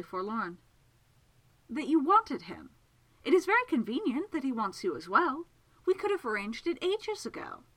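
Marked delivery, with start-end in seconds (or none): 1.7-7.6 high, animated impatience; exasperated explanation. Acted. Miss Absalom explains Segundus's own wishes to him, exasperated. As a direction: spell out the obvious, pitch climbing.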